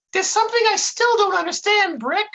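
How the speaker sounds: high, baffled goofball; Dummerton. A narrator's reading. Sidekick still confused by his partner's story, high baffled voice. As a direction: puzzle it out in a sky-high voice. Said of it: "said Dummerton with a baffled expression".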